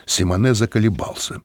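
plain reading voice

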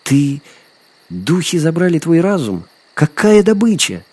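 put-on high-pitched, incredulous girl; climbing, indignant astonishment; amazed protest. A narrator's reading. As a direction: question him in sheer disbelief. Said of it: "девушка изумлённо посмотрела на охотника".

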